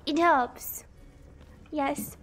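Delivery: ordinary delivery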